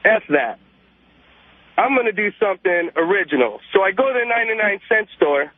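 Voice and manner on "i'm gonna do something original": ordinary talk, nothing marked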